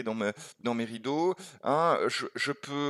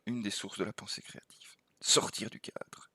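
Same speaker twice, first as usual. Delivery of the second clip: whisper; 4 semitones lower, 8 dB quieter than usual. Real, not acted. Key idea said softly.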